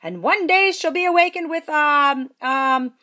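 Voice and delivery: high, loud proclamation; old fairy's blessing. A narrator's reading. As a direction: announce it grandly. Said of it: "Tintinitis continued".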